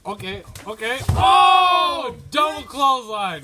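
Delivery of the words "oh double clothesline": sudden loud, high play-by-play yell; excited call. Acted. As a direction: shout the move as it lands. Excited call of a wrestling move.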